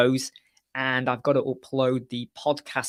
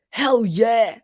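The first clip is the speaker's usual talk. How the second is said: higher, louder hell yeah cheer; enthusiastic cheer; 8 semitones higher, 7 dB louder than usual. Retold or imitated. Telling colleagues what else he could shout, loud triumphant cheer. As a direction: punch it out like a celebration. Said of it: "I could either say".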